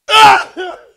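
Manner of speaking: a loud, high burst of laughter. Real, not acted. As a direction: crack up loudly. Laughs after "How dare to me.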